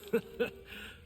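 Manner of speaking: a quick breathy scoffing laugh; short laugh. Acted. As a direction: laugh it off, amused at the threat. Laughs at blind taunt.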